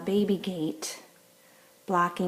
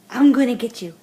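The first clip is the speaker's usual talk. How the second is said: loud, rising higher each time; playful teasing; 8 semitones higher, 8 dB louder than usual. Real, not acted. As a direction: playfully threaten to chase. Playful chase with dog.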